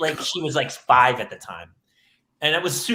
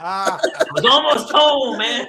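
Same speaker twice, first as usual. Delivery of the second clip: a louder, slower laughing wail of a line; laughing punchline; 6 semitones higher, 5 dB louder, much slower than usual. Acted. Comedian laughing at his own DUI joke punchline.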